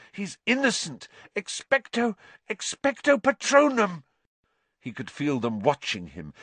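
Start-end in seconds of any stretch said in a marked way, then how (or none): 0.1-4.0 high, desperate plea; plead, then force out the spell up high; gasped. A narrator's reading. Harry defending Sirius from Dementors, gasped. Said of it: "Harry gasped".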